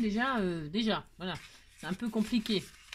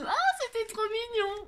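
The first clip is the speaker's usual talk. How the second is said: gushing squeal; 12 semitones higher than usual. Real, not acted. Gushing over cute gift.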